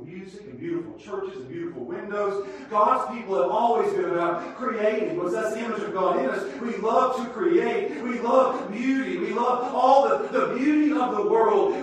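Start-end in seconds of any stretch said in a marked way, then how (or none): 2.7-11.7 loud, high, building sermon cry; preaching at full force. Acted. Preacher lifting the congregation, loud and soaring.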